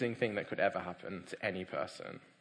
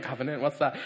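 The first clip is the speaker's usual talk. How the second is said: ordinary talk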